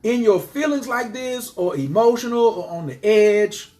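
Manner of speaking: scolding herself. Retold or imitated. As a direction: tell yourself off, sharp and high. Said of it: "But I was like".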